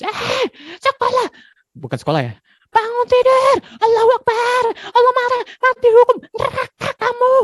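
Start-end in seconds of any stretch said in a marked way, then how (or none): 0.0-1.3 put-on high, slow yell at a child. A parent yells at the child to get to school. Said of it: "jangan sampailah kita teriak-teriak terus". 2.7-7.3 high, loud furious screaming; angry yelling. Retold or imitated. A parent screams at a child to wake up in the morning. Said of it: "jangan sampailah kita teriak-teriak terus".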